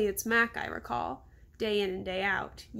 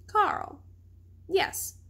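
happy recognition; 9 semitones higher than usual. Acted. Ivan suddenly recalls a keeper.

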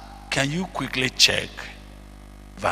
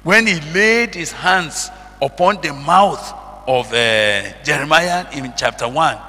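raised, loud preaching in punched phrases; forceful scripture declamation; 4 semitones higher, 5 dB louder than usual. Acted. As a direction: declaim the verse with mounting drive, phrase by phrase. Preacher declaims scripture about Jeremiah.

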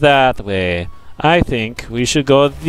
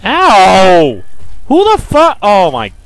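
startled yelp; 3 semitones higher, 5 dB louder, slower than usual. Real, not acted. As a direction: cry out stung and startled. Stung by a bee.

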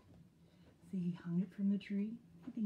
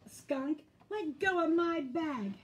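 yelled; 6 semitones higher, 5 dB louder than usual. A narrator's reading.

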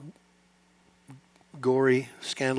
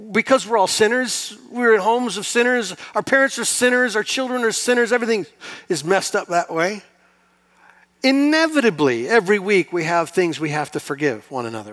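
raised, higher preaching voice; 6 semitones higher, 6 dB louder than usual. Acted. Pastor preaching to the congregation at full force. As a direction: build the sermon up, loud and high.

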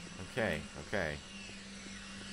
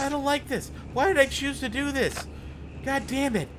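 whiny fear; 11 semitones higher than usual. Real, not acted. Frightened whining at the game.

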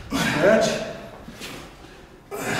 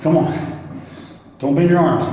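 normal talking voice

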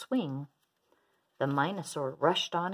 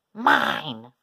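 loud, low-pitched wail; 6 semitones lower, 9 dB louder than usual. A narrator's reading. Minosaur in a tug of war, wailing. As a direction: wail it long and hard. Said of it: "as he wailed".

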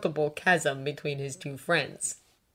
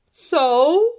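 loud, raised, nosy prompt; prodding; 11 semitones higher, 10 dB louder than usual. A narrator's reading. Draco fishing for Hermione's answer, prodding. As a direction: nudge her for gossip. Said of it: "he prodded".

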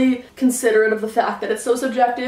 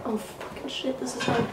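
whispered curse; 10 dB quieter than usual. Real, not acted. Breaks off, struggling to talk.

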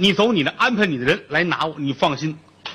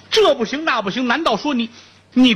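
tearful plea; 8 semitones higher than usual. Acted. Brother pleads, voice breaking.

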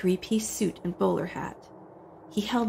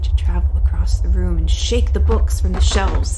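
loud dramatic storytelling; booming narration; 9 dB louder than usual. A narrator's reading. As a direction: boom it over the chaos. Loud climax narration over music.

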